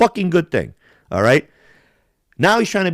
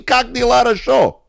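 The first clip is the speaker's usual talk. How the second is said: higher, mocking Russian greeting; mocking, with a higher pitch; 9 semitones higher, 4 dB louder than usual. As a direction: lift it up, teasing. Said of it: "It's the same fucking".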